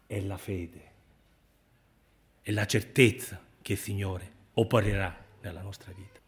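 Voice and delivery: ordinary delivery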